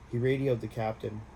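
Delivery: ordinary delivery, nothing marked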